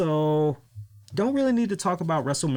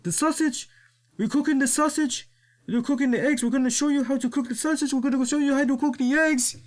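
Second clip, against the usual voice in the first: high, fast, repetitive mock wrestler voice; imitating La Parka; 11 semitones higher than usual. Mocking the wrestler repeating himself on his cooking show, in a high fast voice. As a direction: parrot the dumb line in a high, fast voice. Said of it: "He's like".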